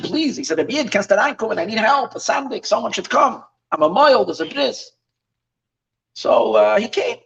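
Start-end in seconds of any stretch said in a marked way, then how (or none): 0.0-0.4 higher, mildly imploring plea; pleading request. Retold or imitated. Mohel asks a passing shoemaker for help, pleading. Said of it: "and he asked him". 0.6-4.9 higher-pitched appeal for help; pleading request. Retold or imitated. Mohel needs someone for the bris, retold pleading. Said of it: "and he asked him".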